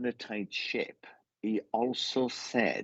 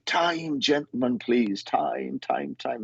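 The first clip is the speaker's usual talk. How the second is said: higher-pitched, drawn-out last-orders call; pub landlord's call; 5 semitones higher, slower than usual. Retold or imitated. Medium imitating landlords calling time in old pubs, sing-out call. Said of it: "they used to say".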